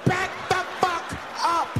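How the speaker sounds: high-pitched frantic yell, acted; flipping out. Cornered man on the bus warning everyone off, flipping out. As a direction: order the crowd back in a mock frenzy. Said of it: "Now the guy flips out".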